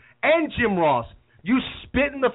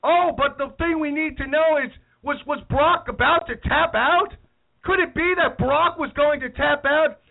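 high excited announcer voice; mock-excited play-by-play call; Michael Cole; 7 semitones higher than usual. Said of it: "we have Michael Cole exclaiming".

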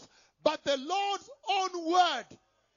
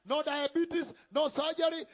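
usual speaking voice

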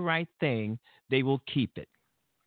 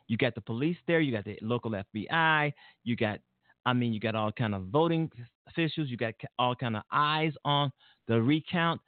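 ordinary talk, nothing marked

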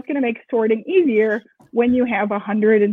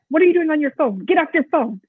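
higher-pitched mock scolding, no real shouting; mock yelling; 5 semitones higher than usual. Boss telling young staff off about phones, mock yelling. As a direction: scold as if yelling, but playfully. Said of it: "young kids that I'm yelling at".

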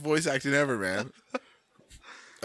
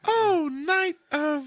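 swooning damsel; 4 dB louder, much slower than usual. Pretend. Acting damsel love scene.

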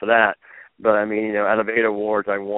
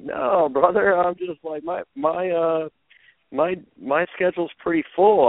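higher-pitched mock-casual reply; breezy retold brush-off; 9 semitones higher than usual. Contestant answering the host on live TV, breezy brush-off. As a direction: brush it off in a lifted, showy voice.

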